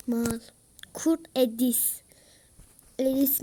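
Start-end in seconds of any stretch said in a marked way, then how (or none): none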